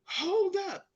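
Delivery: high, loud command; security guard barking at the shoppers to stop; guard's shout. Retold or imitated. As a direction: halt them at the door. Guard stops them at exit.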